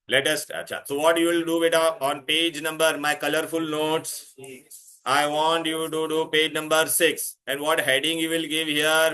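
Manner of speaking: normal talking voice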